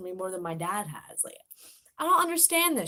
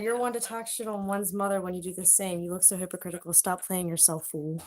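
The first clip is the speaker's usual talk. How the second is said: bratty; 6 semitones lower than usual. Retold or imitated. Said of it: "should I read it to you in my bratty tone".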